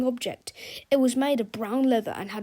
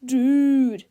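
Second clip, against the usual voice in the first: surfer dude; 3 semitones higher, 9 dB louder than usual. A narrator's reading. Another skateboarder.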